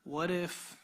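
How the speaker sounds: normal talking voice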